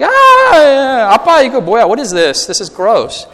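high, loud shriek of disgust, fading; kid's disgusted shriek. Retold or imitated. Pastor as a boy shrieking at his dad over the suit. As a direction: squeal like a grossed-out kid finding something. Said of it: "I'm like".

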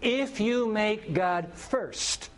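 each word drawn out, slow and weighty; slow emphatic preaching. Acted. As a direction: deliver the key truth word by word. Repeated key point.

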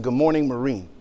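very low, soft sergeant's salute; retold quiet acknowledgement; solemn greeting. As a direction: quote it hushed and grave. Sergeant's salute in friend's solemn account.

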